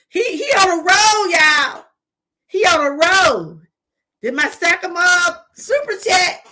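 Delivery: loud, high hype; cheering on a donor who sent a super chat; excited shout-out. Real, not acted. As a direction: hype him up loudly.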